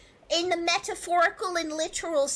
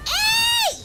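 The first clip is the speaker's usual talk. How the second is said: shout; 7 dB louder than usual. Real, not acted. Game protest.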